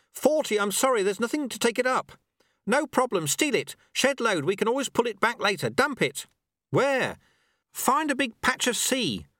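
high-pitched, rapid-fire crisis exchange; urgent calling. Acted. Sweeper telling apprentice to dump time, urgent calling. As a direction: shout solutions across the hall.

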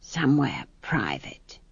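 soft, slow and secretive; conspiratorial whisper. A narrator's reading. Granny asks for somewhere private, conspiratorial whisper. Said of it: "added with a conspiratorial whisper".

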